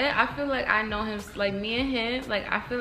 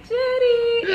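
high, loud sung-out name; sing-song baby call; 9 dB louder than usual. Retold or imitated. Mother calling to her giggling baby, playful singsong.

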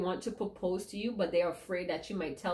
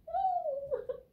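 high giggle. Real, not acted. Laughs at the card reading.

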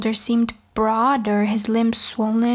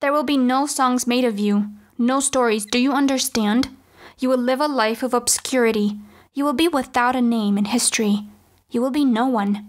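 ordinary delivery

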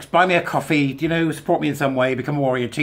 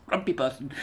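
whispered; 2 semitones higher, 5 dB quieter than usual. Shown as a demonstration. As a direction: whisper it with a small laugh.

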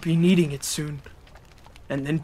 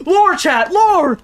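excited shout; 12 semitones higher, 7 dB louder, much slower than usual. Real, not acted. Excited about story lore.

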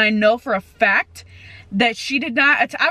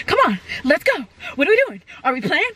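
higher, bouncy voice for the dog; eager dog voice; 4 semitones higher, faster than usual. Retold or imitated. Voicing her dog Sadie nagging another dog, lightly raised. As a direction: voice the puppy begging to play. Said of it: "she'll be like".

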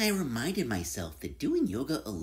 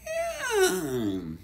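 a long stretched-out yawn sound, high; big yawn; 4 dB louder than usual. Acted. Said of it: "let out a big".

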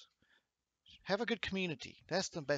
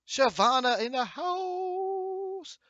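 stretched out, way up high and louder, like a ring announcer; hype announcer; 6 dB louder, much slower than usual. Acted. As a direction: draw it out big to welcome her in. Hyping a chat member's arrival.

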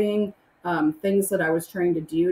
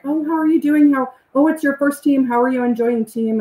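higher, louder friendly small talk; mock chirpy greeting; 6 semitones higher, 6 dB louder than usual. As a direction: chirp it like an eager fan. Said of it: "they would ask me".

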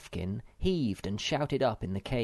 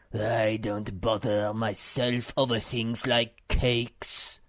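slow, ponderous philosopher voice; solemn muskrat; 3 dB louder, slower than usual. A narrator's reading. Philosopher disdains cakes before the mother, solemn. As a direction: pronounce it slowly, with solemn weight. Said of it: "twisting his mustache solemnly".

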